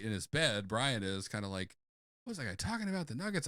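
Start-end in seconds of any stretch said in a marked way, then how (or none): 2.2-3.5 high, soft bewildered question; mock late-night bafflement; puzzled musing. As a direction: wonder aloud in a light voice. Brian puzzling alone in bed.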